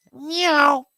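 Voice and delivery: high loud meow; high squeaky cat meow. Acted. Cat answering.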